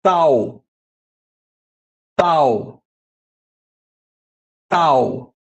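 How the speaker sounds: Alfa said slowly three times, each with a falling tune; careful repetition. Shown as a demonstration. Teacher modelling the Greek letter names for the class. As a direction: model each syllable of Alfa for students to copy. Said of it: "Eu vou falar três vezes".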